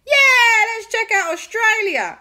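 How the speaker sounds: high, loud group cheer; excited cheer. Acted. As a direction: shout it like a thrilled crowd. Everyone cheers the plan.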